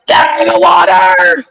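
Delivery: high-pitched mock scream of a warning; imitated scream. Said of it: "she was just screaming".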